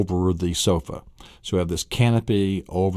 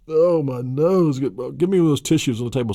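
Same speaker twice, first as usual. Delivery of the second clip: whiny sick voice; 7 semitones higher than usual. Pretend. Sick family member whining.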